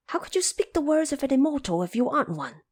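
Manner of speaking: high, fast and insistent; eager Monkey King. Acted. Monkey King challenging the woodcutter, high and quick. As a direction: press the woodcutter like a cheeky monkey.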